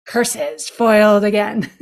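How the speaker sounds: slow, hammed-up villain growl; sneering panto villain. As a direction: spit it like a thwarted villain. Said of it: "this is the world's shortest play".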